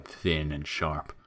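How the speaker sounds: usual speaking voice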